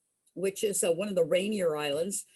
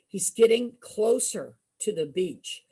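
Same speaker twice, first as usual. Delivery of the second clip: ordinary delivery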